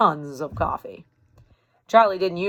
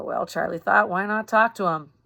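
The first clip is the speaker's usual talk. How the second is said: ordinary delivery, nothing marked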